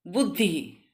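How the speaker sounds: normal talking voice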